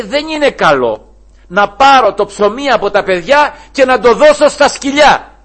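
loud, stern proclamation. Acted. Preacher declaiming the Lord's words, loud and slow. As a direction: proclaim it to the crowd.

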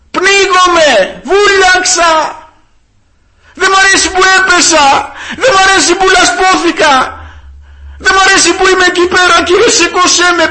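high, loud shouted plea for rescue; drowning cry. Acted. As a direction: shout for help as if going under. Acting a drowning man crying for help. (κραυγή απελπισίας για βοήθεια)

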